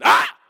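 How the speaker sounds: loud sudden yelp of pain; pained shout. Retold or imitated. Pastor re-enacting his own shout from the story, loud yelp. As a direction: shout it out like the kicked bumper. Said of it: "just like me when I said".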